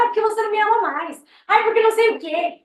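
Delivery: put-on high, whining complaint of a jealous teenager; mock a sulky kid who feels unloved; lightly hammed-up whine; whiny teen. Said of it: "Eu falava".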